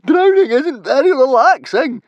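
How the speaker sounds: very high, loud panicked screech; shrieking. Acted. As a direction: screech in drowning hysteria. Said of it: "shrieked Fishlegs".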